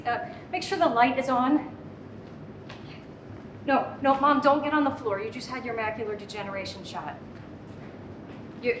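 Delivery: usual speaking voice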